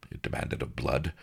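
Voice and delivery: ordinary delivery, nothing marked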